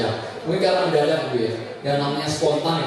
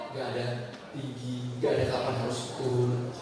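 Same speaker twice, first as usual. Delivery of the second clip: low and soft; 4 semitones lower, 9 dB quieter than usual. Shown as a demonstration. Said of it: "Kapan tinggi rendahnya?".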